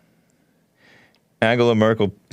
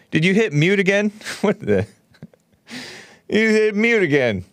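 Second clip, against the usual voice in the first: high, amused disbelief; exasperated; 7 semitones higher than usual. Real, not acted. Caller muted again.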